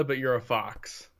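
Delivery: ordinary delivery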